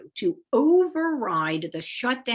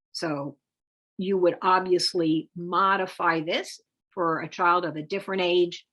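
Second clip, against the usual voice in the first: normal talking voice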